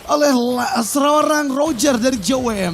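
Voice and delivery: slow, drawn-out hype call; excited play-by-play. Acted. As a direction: stretch the call for drama. Live teamfight play-by-play.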